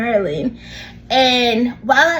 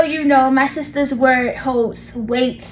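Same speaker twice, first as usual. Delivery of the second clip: ordinary talk, nothing marked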